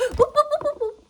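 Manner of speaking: gasp, then very high, loud boops; startled boop. Real, not acted. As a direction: squeak boops at the dog. Startled dog climbs the table.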